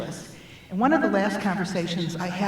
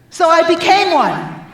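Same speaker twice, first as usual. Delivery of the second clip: higher and much louder, a proud burst; triumphant punchline; 9 semitones higher, 10 dB louder than usual. Real, not acted. To the audience, the punchline of never marrying a doctor, triumphant and loud. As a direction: deliver the twist with a big lift.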